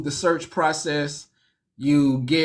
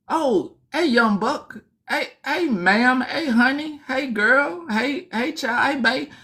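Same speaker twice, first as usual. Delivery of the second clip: higher sing-song greetings; friendly inmates greeting; 8 semitones higher, slower than usual. Retold or imitated. Inmates greeting the newcomer in a higher sing-song. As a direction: call out sweetly to the new arrival. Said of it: "They go".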